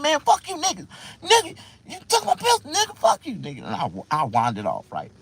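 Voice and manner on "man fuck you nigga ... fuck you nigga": put-on high, loud drunken outburst; re-enacted pissed-off yelling; angry cursing out. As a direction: curse out your cousin in a raised, indignant voice. Acts out cursing his cousin.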